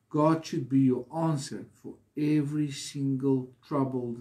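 normal talking voice

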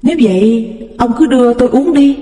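gladly urging her husband; lightly voiced cheerful wife. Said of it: "Bà Tâm tỏ vẻ vui tươi". (bà Tâm vui tươi nói với chồng)

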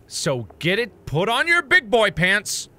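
a pretend stern captain whipping the crew into shape; mock pep talk. Mock rallying speech to team.

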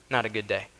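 ordinary talk, nothing marked

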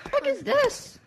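high, sudden alarmed squeal; startled yelp. Real, not acted. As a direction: jump up in surprise. Startled in game.

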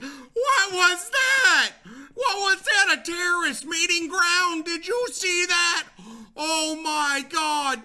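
loud, high, drawn-out wails; upset shouting. Real, not acted. Player ambushed while mining, upset high shouting. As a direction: yell in shocked outrage, stretching the words. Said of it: "I'm so upset".

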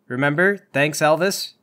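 normal talking voice

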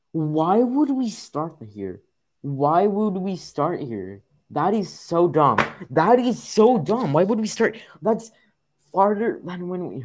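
loud, high, drawn-out complaint, climbing; exasperated rant. Real, not acted. Player sent back by the game, loud exasperated rant. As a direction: wail in disbelief at the unfairness. Ranting at restart point in game.

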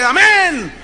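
high, loud congregational shout. Retold or imitated. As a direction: imitate a roused church answering. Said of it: "Más fuerte".

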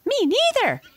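very high, squeaky little voice; tiny mouse voice. Acted. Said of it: "said a tiny little voice".

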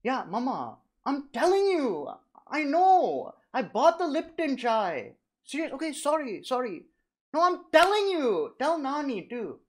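mock call home to reassure mum; pretend phone call.

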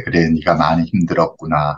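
low, gentle comforting tone. Shown as a demonstration. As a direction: soothe the child once the crying has passed. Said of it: "그때 위로해 주셔야 돼요". (낮고 다정하게 위로하는 말투)

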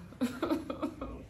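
giggle. Real, not acted. Laughing before news.

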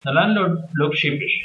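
ordinary delivery, nothing marked

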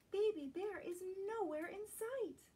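plain reading voice

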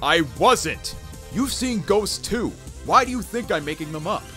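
high-pitched, exasperated defence; indignant protest. Acted. To a doubting teammate about ghosts, indignant. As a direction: protest hotly at being doubted. Defends self against blame.

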